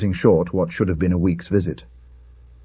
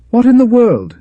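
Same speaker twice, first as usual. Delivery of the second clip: much higher and louder, a sudden startled cry; startled exclamation; 7 dB louder than usual. Acted. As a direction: blurt it out high in alarm. Friend exclaims at the fall.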